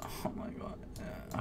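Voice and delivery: whispered oh my god; quiet exasperated breath. Real, not acted. Vlogger whispers in frustration after checkout.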